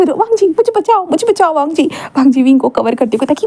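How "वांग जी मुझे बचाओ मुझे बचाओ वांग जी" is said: mock cry for help. Wing mock-calling for rescue.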